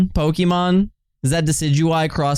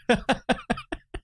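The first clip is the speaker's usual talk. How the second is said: quiet giggle; 10 semitones higher, 6 dB quieter than usual. Real, not acted.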